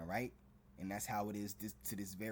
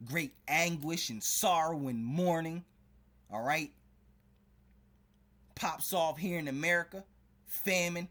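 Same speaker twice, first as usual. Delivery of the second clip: slow, louder warning cries from the pulpit; fiery preaching; 3 semitones higher, 5 dB louder than usual. Acted. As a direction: preach the coming doom at full force. Warns of coming judgment.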